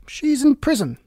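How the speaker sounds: high, sharp royal command; angry Queen. Acted. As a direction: bark it like a furious queen. Queen orders executioner.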